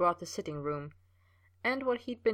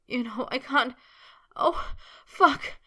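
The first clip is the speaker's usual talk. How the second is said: rising, louder wail into a breathy cry; desperate wail, far higher and louder; 7 semitones higher, 7 dB louder, slower than usual. A narrator's reading. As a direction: let it break into a wail at the climax. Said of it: "he wailed".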